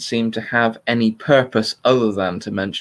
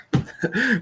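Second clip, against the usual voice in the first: short laugh; 13 semitones higher, 3 dB quieter than usual. Real, not acted. Laughs at his own garbled sentence.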